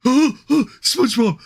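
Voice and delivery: slow, high dopey shout; Patrick Star voice. Pretend. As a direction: call out like dim Patrick.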